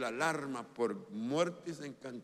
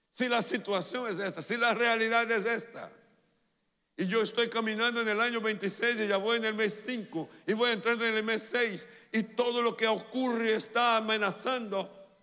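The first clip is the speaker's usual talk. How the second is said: loud, high, driving preacher voice; urgent preaching; 9 semitones higher, 7 dB louder than usual. Real, not acted. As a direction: build urgency in a raised, pressing voice. Preaches at full force.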